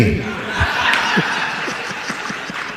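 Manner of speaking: soft breathy chuckle; a short hushed laugh. Real, not acted. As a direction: laugh quietly at the joke. Laughing at own joke.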